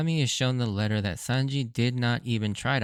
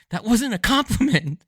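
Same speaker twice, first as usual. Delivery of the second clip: loud, high mock-exasperated outburst; exasperated yell; 11 semitones higher, 6 dB louder than usual. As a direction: imitate a fed-up agent yelling back. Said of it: "the agent exasperatedly yells".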